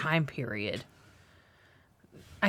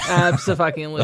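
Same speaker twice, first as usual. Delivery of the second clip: loud mock emphatic agreement; sarcastic; 7 dB louder, faster than usual. Real, not acted. Friend jokes back about the movie, loudly sarcastic.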